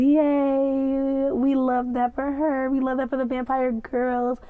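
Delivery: bright high cheer; cheering fan. Real, not acted. Fan cheering the vampire girls. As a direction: celebrate them happily.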